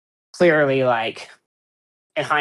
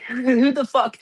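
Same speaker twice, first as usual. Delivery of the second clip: exasperated; 9 semitones higher, slower than usual. Retold or imitated. Mock exasperated fan rant.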